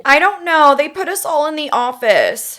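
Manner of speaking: put-on high, pleading frightened girl; 911 caller. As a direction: beg for help, panicked. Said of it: "I am going to be Melissa".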